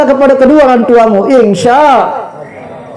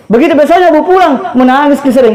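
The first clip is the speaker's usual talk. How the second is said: usual speaking voice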